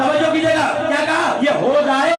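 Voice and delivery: high, loud climactic majlis preaching; preaching at full force. Acted. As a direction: drive the story to its peak, shouting. Climax of the Karbala-style narration. (پورے جوش سے بلند آواز میں خطابت)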